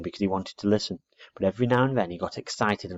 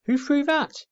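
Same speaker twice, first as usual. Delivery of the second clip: surprised; 3 dB louder, slower than usual. Acted. Boy startled by thud.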